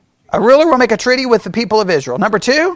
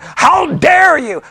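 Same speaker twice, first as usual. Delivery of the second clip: slow, high indignant shout; mock outrage; 7 semitones higher, much slower than usual. Mocking angry preterists, slow offended high shout. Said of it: "preterists get mad".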